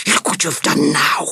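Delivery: put-on tense, hissed reproach at a child; hissing with anger; angry hiss. A narrator's reading. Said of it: "he hissed at Peter".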